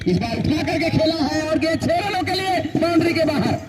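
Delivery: rising, thrilled call of a six; excited cricket commentary. Real, not acted. Six hit, commentator calls it.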